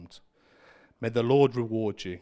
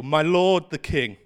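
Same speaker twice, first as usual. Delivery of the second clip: calling out; 4 semitones higher, 7 dB louder than usual. Acted. David calls out to the king.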